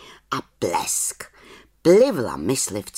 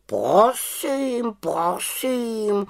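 offended raven; 11 semitones higher, much slower than usual. Acted. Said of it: "urazil se Abraxas".

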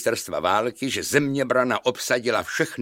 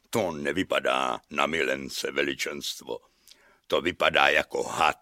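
low, soft castellan voice; subdued servant; 9 semitones lower, 5 dB quieter than usual. A narrator's reading. Castellan answering the shouting king, low and subdued. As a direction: drop low and quiet to answer.

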